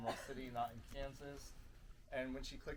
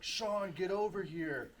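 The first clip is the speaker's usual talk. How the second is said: calling out; 6 semitones higher, 5 dB louder, much slower than usual. Retold or imitated. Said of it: "I hear Miles like".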